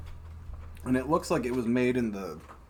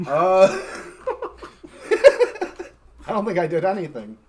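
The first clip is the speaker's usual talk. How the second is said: laughing through the words; 6 semitones higher, 7 dB louder than usual. Real, not acted. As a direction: giggle while you answer. Laughing at the question.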